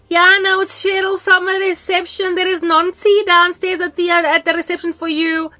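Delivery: high, loud office-phone voice; receptionist on the phone. Retold or imitated. Receptionist Cheryl tells her a visitor waits downstairs, high and loud. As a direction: announce the visitor like a polite receptionist.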